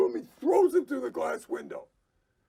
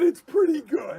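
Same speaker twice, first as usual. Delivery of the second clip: higher, louder mocking echo of the caller; mocking quote; 6 semitones higher, 7 dB louder than usual. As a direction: throw the caller's words back, loud. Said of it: "And he said".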